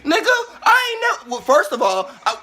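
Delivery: loud, high, shouted tirade; raging shout against the commercial; furious rant. Real, not acted. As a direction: rip into Pepsi at full volume. Angry rant at Pepsi ad.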